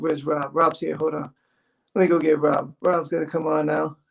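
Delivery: low voice put on as Gary; Gary impression. Singer thanks the audience and brings on the host, Gary impression. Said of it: "You pretending to be me".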